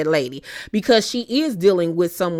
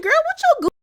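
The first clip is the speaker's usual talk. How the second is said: much higher, fast, sassy retort; exasperated retort; 11 semitones higher, faster than usual. Pretend. Speaker scolds Trisha for laughing, high and sassy. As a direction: snap it back at her, incredulous. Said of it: "And I'm like".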